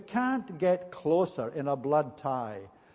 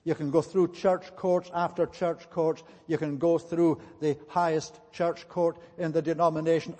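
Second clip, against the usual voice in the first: normal talking voice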